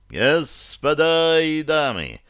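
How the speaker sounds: slow, high ringing proclamation; solemn booming herald. Acted. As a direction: call it out slowly to the whole hall. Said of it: "торжественно и зычно заговорил он".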